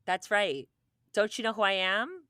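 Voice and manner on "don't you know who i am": mock indignant, higher and louder; entitled indignation. Hosts mimic someone stopped at security, entitled indignation. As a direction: put on a bit of self-important outrage.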